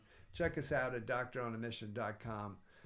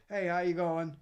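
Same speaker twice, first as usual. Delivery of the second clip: loud, high, breezy throwaway hello; loud careless hello in passing; tossed-off greeting; 6 semitones higher, 9 dB louder than usual. Retold or imitated. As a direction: fling the greeting out without waiting for an answer. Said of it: "am I just making a statement".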